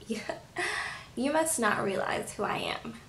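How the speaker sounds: soft menacing whisper; smug whisper. Retold or imitated. Playing a scheming reality star, taunting in a whisper. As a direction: breathe it like a secret threat. Voicing Lizzie's smug threat.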